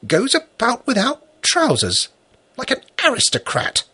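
put-on high childlike disapproving voice; little girl voice. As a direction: scold like a prim little girl. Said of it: "remarked disapprovingly".

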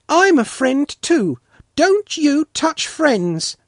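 put-on high, loud child addressing a dog; bold little girl. A narrator's reading. Svetlana wiggling a finger at Polkan, high and firm. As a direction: tell the dog firmly, slowing on the warning.

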